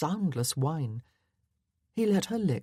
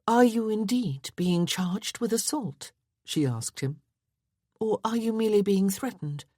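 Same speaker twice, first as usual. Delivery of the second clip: lightly raised ladylike voice; a touch higher, a refined young lady; Miss Westcott voice; 6 semitones higher than usual. A narrator's reading.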